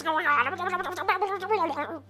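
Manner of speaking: high, whimpering panic; terrified Plop. Acted. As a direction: babble thanks and fear in a high voice. Game master voices terrified Plop.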